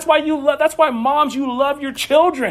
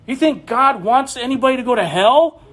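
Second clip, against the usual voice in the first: ordinary delivery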